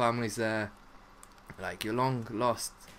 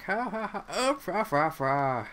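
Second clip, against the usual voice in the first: higher, louder, slow mocking laugh-talk; mock silly laughter; 8 semitones higher, 4 dB louder, slower than usual. Imitating everybody's goofy laughing reaction, hammed up. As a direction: ham up a goofy chortle. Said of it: "everybody's like".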